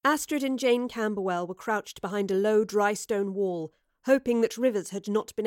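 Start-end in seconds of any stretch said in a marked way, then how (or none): none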